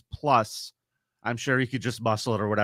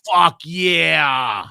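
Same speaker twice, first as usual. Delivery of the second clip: loud, high, whooping yes; excited shout; 5 semitones higher, 7 dB louder than usual. Real, not acted. Host answering a fan about attending an event, excited shout. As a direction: shout it out with gusto.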